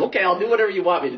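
hammed-up mom caving to her son; imitating wife. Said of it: "she's like".

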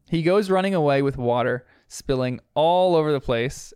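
normal talking voice

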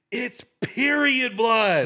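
very high, drawn-out loud yell; retold yell. Storyteller mimics sister yelling the reveal, long high yell. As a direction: imitate her screaming it out slowly at full pitch. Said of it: "She's yelling at me".

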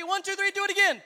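big shouted count-in; loud call-out. Real, not acted. Urging crowd to repeat louder.

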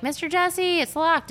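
put-on high, thin child voice; girl calling to the man under the car, high and worried; kid calling out. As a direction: call out like a nervous little girl. Girl calls to tow man.

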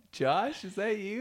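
high, loud calling out; mock surprised call. Acquaintance in the crowd recognizing the worker, high imitated call.

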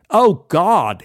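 a polite English gent's greeting; English accent. Retold or imitated. English stranger greets narrator at the bar, lightly English. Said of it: "he said".

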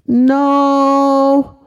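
high, loud whiny "No."; childish refusal. Acted. Little girl refusing the idea, high and whiny. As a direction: whine it like a small child.

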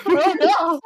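high, laughing outburst of dismay. Real, not acted. Player told of weird body marks, laughing dismay. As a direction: cry out "oh no" through laughter.

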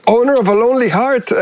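name the song like you just caught the reference; the moment both recognize the riff, excited; excited recognition. Retold or imitated. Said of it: "la oímos y dijimos".

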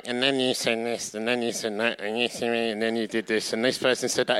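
mild mock whiny complainer; a hint of a petty tattler listing grievances; lightly voiced nagging complainer; complaining voice. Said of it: "that is always the complaining voice".

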